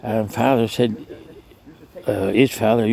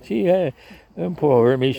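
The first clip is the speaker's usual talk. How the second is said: ordinary talk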